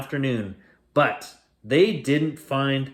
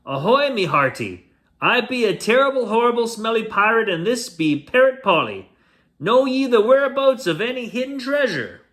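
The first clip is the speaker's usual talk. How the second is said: higher and louder, fast and brash; a swaggering pirate shouting a greeting; pirate calling out; 8 semitones higher, 4 dB louder than usual. Acted. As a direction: hail her across the water. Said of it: "called out".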